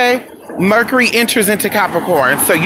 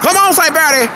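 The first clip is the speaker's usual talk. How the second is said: hype shout-out; 10 semitones higher than usual. Real, not acted. Shouting out to a viewer.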